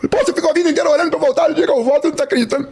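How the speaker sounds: high, fast protesting; exasperated son. Retold or imitated. Son protesting that his praying father doubts him, exasperated.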